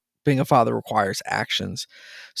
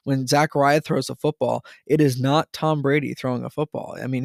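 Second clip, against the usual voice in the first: normal talking voice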